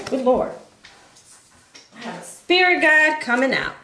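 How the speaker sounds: ordinary delivery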